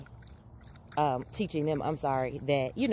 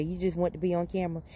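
ordinary delivery

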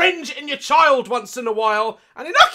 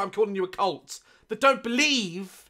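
usual speaking voice

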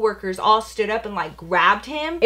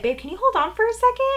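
high, quick, polite request; sweet phone aside; 14 semitones higher, faster than usual. Retold or imitated. Girlfriend asking boyfriend on the phone to hold on, high and quick. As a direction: ask lightly in a raised, hurried voice.

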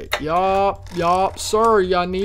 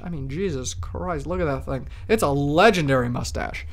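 ordinary talk

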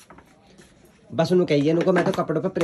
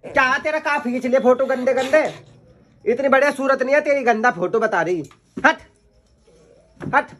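higher and louder playful scold; 10 semitones higher, 4 dB louder than usual. Real, not acted. Teasing the little girl about her ugly photos, playful higher scold.

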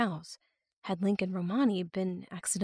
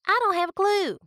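put-on rural accent; 13 semitones higher, 6 dB louder than usual. A narrator's reading. Other captor voiced.

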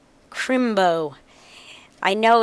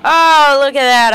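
high, loud excited gasp; light up at the find; delighted oh look; 6 semitones higher, 8 dB louder than usual. Real, not acted.

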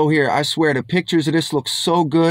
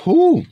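high, louder whew of amazement; 14 semitones higher, 5 dB louder than usual. Real, not acted. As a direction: blow out a whew at the flavour. Reacting to the taste.

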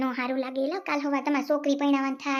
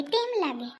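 usual speaking voice

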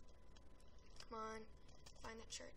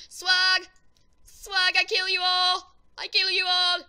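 hyped battle shout; 5 semitones higher, 17 dB louder, slower than usual. Real, not acted. Gamer hyped during the match.

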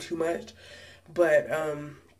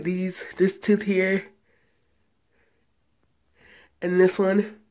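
talking with lip held open; lip pulled down by finger; 2 semitones higher, 5 dB louder, slower than usual. Real, not acted. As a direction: finger in mouth speech. Seen 0: Finger pulls lower lip down, showing braces, throughout labelled words.

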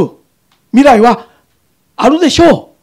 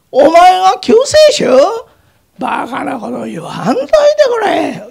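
scornful mockery; 5 semitones higher than usual. Soldiers mock Jesus again.

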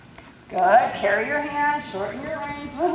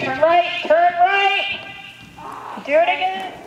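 calling out; 6 semitones higher, 4 dB louder than usual. Real, not acted. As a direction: raise the voice to carry across the arena. Coach calling out to rider.

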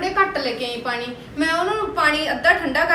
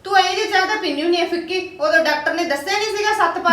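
angry retort; 3 semitones higher than usual. Acted. Daughter-in-law shouting back at her mother-in-law over the tea, angry.

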